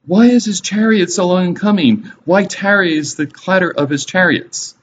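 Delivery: high-pitched acted cry of a waiting mother. Mother of Sisera looking through the window for him, crying out. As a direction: call out the question high, in dramatic reading. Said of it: "cried out through the lattice".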